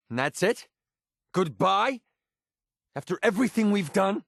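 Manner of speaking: high-pitched, incredulous questioning; can't believe this is goodbye; hurt disbelief. Acted. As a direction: pitch jumps up in wounded disbelief. Acted breakup, hurt disbelief.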